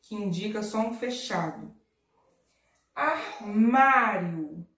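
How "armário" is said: Armário very slow, MA long and loud; stress on MA. Shown as a demonstration. Teacher showing the strong syllable, Armário with long MA. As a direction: stretch and punch MA in Armário. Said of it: "a sílaba mais forte é o ma".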